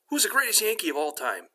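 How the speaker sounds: high-pitched prompting question; acted quiz question, pitched well up; dog owner quizzing. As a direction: pose it eagerly to your dog.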